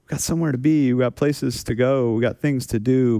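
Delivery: ordinary talk, nothing marked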